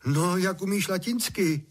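high, curious question; eager questioning. A narrator's reading. Father quizzes son about his Latin, curious. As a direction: ask it with lifted, expectant pitch.

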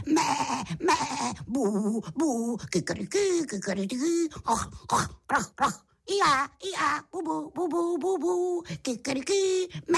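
high run of bleats, moos, crows, grunts and brays; practising animal languages. A narrator's reading. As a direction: rattle through barnyard noises in a high voice. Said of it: "ty cizí řeči opakoval". (vysoké zvířecí zvuky jeden za druhým)

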